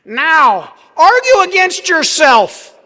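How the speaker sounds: high and loud, booming the challenge; professor's challenge. Retold or imitated. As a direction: call it out like a professor cold-calling a student. Professor's classroom challenge retold.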